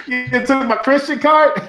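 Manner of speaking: laughing, pitched high. Real, not acted. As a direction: laugh through the question. Friend jokingly excluded from Christians.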